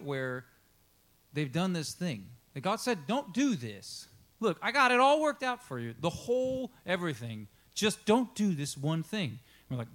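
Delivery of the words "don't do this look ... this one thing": raised, emphatic plea with drawn-out key words; God's firm warning. Acted. God to Adam and Eve about the one forbidden thing, firm warning. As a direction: lay down the one rule, slow and high. God warns them, voiced.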